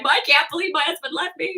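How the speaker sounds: high, whimpering complaint; mock distraught. Wife left alone at party, mock distraught. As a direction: retell it as a self-pitying near-sob. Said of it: "messed up in my head".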